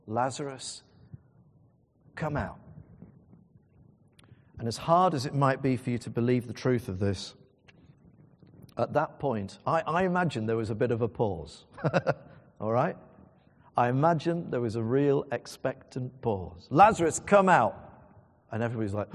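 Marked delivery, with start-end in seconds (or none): 0.1-2.6 measured call, not raised; understated call. Retold or imitated. Jesus calling Lazarus from the tomb, understated. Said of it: "he says in a loud voice". 16.7-17.7 loud, higher commanding call. Retold or imitated. Jesus calling Lazarus out of the tomb, loud. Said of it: "he says in a loud voice".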